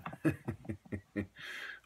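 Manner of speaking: chuckling at own joke; amused chuckle. Real, not acted. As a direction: let out a short run of chuckles. Laughs at own joke about Tubman.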